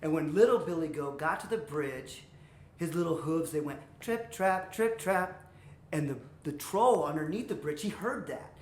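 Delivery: usual speaking voice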